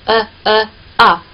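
呃呃啊 slow and steady, opening on 啊; slow rhythmic 呃呃啊; vowel drill. Shown as a demonstration. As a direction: chant 呃呃啊 slowly. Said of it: "发一个啊的这个音".

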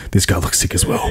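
unvoiced whisper; whispered aside. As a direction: whisper it to yourself. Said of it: "he was just like".

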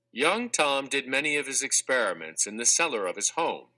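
plain reading voice

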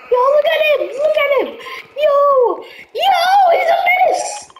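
screaming about the menacing clown; excited yelling. Real, not acted. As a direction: point and yell in disbelief.

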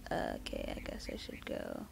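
hushed, unvoiced aside to self; very soft, whispered resignation; resigned whisper. Real, not acted. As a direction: whisper it under your breath.

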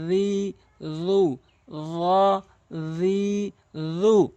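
drawn-out vowels. Shown as a demonstration. Teacher demonstrating long vowels to viewers, slow and stretched. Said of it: "টেনে টেনে পড়তে হবে".

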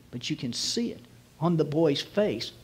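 ordinary talk, nothing marked

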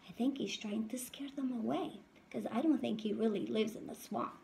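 lively storybook read-aloud voice; reading to children. A narrator's reading. Teacher reading a picture book to her class, lively. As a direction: read it out brightly to the little ones.

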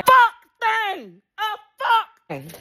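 mad high rant. Real, not acted. Mad rant, climbing pitch.

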